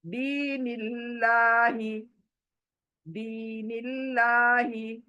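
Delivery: very slow दीनिल्लाही with a thin लाम; thin Allah. Shown as a demonstration. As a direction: stretch दीनिल्लाही out, lips wide. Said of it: "तो देखिए कैसे बारीक हो रहा है".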